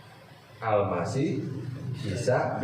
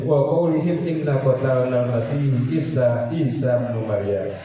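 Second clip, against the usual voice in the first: Quran recitation; 5 dB louder than usual. Acted. Reciting Quran verse in sermon.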